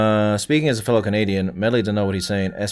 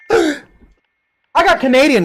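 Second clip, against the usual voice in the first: gasps, then blurts it out much higher and louder; gasp of surprise; 14 semitones higher, 4 dB louder than usual. Real, not acted. Streamer gasping at a chat message, surprised. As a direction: caught off guard by what chat just wrote.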